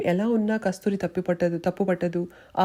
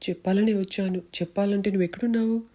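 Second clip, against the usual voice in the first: usual speaking voice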